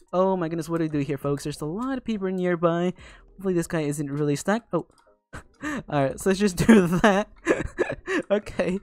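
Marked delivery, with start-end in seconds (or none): none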